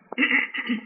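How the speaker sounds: short dry throat-clearing cough. Real, not acted. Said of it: "I've got a frog in my throat".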